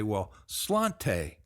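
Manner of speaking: put-on Irish word in a slow American accent; "sláinte" drawn out American style, ending slawn-tay. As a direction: say sláinte the Texas way, slowly. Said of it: "I prefer to call that American accent".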